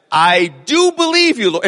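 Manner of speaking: high, earnest plea to the Lord; pleading believer. Retold or imitated. The church praying to the Lord, high earnest plea. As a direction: lift it up like a half-hearted believer.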